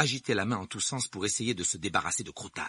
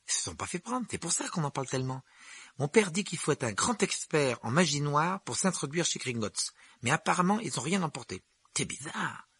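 put-on higher boy's voice, gossiping; animated, pitched-up character voice; chatty young Ron; 7 semitones higher than usual. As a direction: play a young wizard passing on news. Narrator voices Ron in dialogue.

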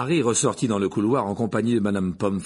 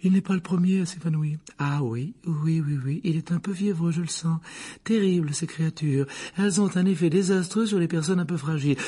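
higher-pitched matron chattering to herself; fussy nurse; 7 semitones higher than usual. A narrator's reading. Nurse examining a feverish boy, fussing. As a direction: fuss over the patient in a pitched-up voice.

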